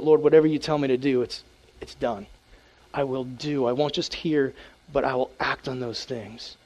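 low, soft, yielding prayer; soft prayer of surrender; hushed surrender prayer. Pretend. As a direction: give in quietly to the Lord.

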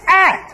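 loud, high strangled yelp; mock stuck yelp. Short mom hanging from the car window, mock yelp. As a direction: cry out as if caught by the arm. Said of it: "gets stuck in the window".

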